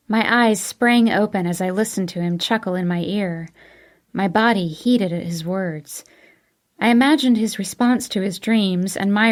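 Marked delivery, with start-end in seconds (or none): none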